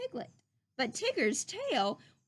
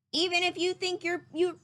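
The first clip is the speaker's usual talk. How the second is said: a lightly voiced fussy rabbit; mild rabbit lecture; Rabbit lecturing; 5 semitones higher, 3 dB louder than usual. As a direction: scold the plants a little, mildly higher. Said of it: "he told his plants".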